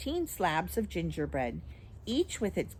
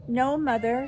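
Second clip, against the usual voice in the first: higher, timid little answer; meek; 6 semitones higher, 5 dB louder than usual. A narrator's reading. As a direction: answer Mother meekly. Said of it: "said Miss Fanny meekly".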